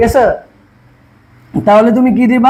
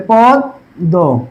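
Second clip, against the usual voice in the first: পদ্ম stretched out very slowly as পদ্দো; slow pronunciation demo; 4 semitones lower, much slower than usual. Shown as a demonstration. Teacher showing class how পদ্ম doubles its দ, slowly. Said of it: "তাহলে কি হবে?".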